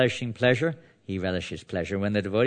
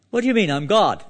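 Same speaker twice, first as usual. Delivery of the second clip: high, loud indignant disbelief; incredulous; 10 semitones higher, 7 dB louder than usual. Acted. Krishna protesting to his crying wife, incredulous. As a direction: protest in baffled, hammed-up outrage. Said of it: "that was the mood".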